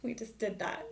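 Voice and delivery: mock-cool, whispery swagger; imitating a smugly casual drummer; nonchalant. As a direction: shrug it off, pretending it was nothing. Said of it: "don't be so nonchalant".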